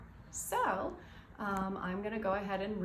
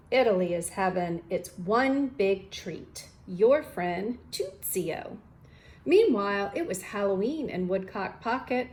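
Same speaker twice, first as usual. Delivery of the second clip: lively, louder storybook read-aloud; reading to children; 7 dB louder than usual. Acted. Teacher reading a picture book to students, lively storytime voice. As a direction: read it brightly for the class.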